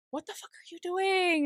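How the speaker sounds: high, startled protest; alarmed outburst. Retold or imitated. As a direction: recoil in alarm. Will alarmed at Kala.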